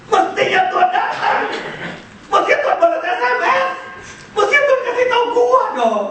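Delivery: high, whiny mock complaint; frustrated family complaint. Mary's family complaining that Jesus never came. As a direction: whine it like a let-down sister. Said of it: "Statement keluarga".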